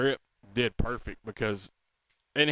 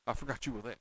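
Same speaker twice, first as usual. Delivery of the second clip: put-on Doc Holliday's Georgia accent; 10 dB quieter, much faster than usual. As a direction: quote it quietly in a Southern accent. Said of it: "Talks like he was from Savannah".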